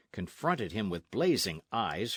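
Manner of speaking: usual speaking voice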